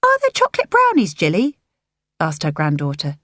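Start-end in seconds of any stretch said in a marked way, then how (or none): 0.0-1.6 put-on high, eager child's voice; little girl. A narrator's reading. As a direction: play the small granddaughter asking sweetly.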